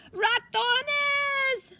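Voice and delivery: high, loud startled cry; alarmed cry. Acted. Grandmother spotting mouse signs, high startled cry. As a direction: yelp at the sight of mice. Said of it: "she cried".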